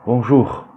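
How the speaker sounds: "bonjour" loud, with a heavily sounded R; overdone final R. Shown as a demonstration. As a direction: hit the final R of "bonjour" too hard. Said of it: "Et c'est pas non plus". (« bonjour » fort, avec un R trop appuyé)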